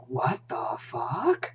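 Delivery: very slow, drawn-out whisper rising at the end; mock bewildered whisper. Co-host voicing a look shared with Mike, stunned whisper. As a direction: whisper it in stunned disbelief, stretching it out.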